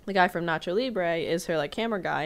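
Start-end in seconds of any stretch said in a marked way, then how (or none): none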